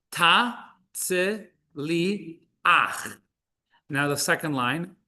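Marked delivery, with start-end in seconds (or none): 0.1-3.1 "ta tzi li ach" drawn out beat by beat; very slow syllables. Shown as a demonstration. Hebrew teacher reading word list, very slow syllables. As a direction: sound out each syllable slowly. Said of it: "our fourth word here".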